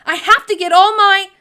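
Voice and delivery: high, loud cut-off shout; yelling. A narrator's reading. Sophie still yelling at Mister Pudding, loud and high. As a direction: yell until interrupted. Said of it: "Sophie yelled".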